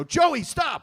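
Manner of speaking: loud, high shout to stop; retold parental yell. Dad catching his son misbehaving, shouting sharply. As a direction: yell at the kid to quit it.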